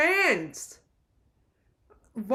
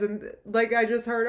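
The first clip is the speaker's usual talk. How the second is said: ordinary delivery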